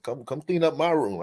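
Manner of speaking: ordinary talk, nothing marked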